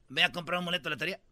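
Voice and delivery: tossed-off quick mumble of advice; fast throwaway repeat of the same tip; rushed advice. Retold or imitated. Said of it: "¿Quieres ganarte la lotería?".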